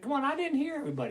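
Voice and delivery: usual speaking voice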